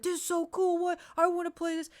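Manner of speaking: put-on high, loud, gushing kid-brother voice; thrilled younger brother. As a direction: mimic a brother bursting with envy and excitement.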